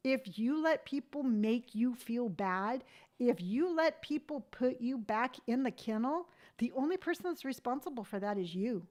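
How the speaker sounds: ordinary delivery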